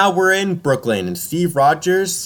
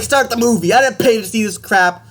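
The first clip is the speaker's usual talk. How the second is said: retold shout; 6 semitones higher, 3 dB louder than usual. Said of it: "this dude's shouting".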